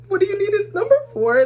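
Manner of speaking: high, fast, loud comeback; incredulous protest. Retold or imitated. Daughter questioning mom's demand for his number, incredulous. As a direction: blurt it out high and indignant, half laughing. Acting her panicked protest to mom.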